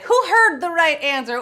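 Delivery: ordinary talk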